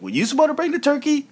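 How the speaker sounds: high, quick blaming voice; mock accusing question. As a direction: hurry to pin the missing turkey on Biggie. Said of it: "It's like".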